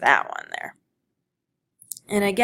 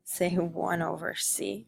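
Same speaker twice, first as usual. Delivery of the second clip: whispered aside; 9 dB quieter than usual. Real, not acted. Teacher mutters aside to herself.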